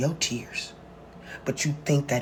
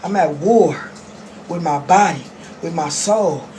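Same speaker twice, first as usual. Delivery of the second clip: loud, raised, impassioned declaration; war cry; 4 semitones higher, 6 dB louder than usual. Real, not acted. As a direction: call it out like a fighter going to battle. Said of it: "Let me have my war cry".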